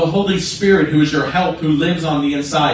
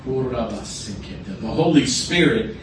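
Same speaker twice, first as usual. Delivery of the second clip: soft, hushed prayer guidance; hushed altar-call voice; quiet prayer leading; 3 semitones lower, 6 dB quieter than usual. Real, not acted. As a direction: lead them gently into prayer. Prayer leading, eyes closed.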